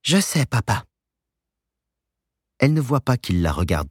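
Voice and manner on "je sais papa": put-on young woman voice. A narrator's reading. Joanne voiced in story.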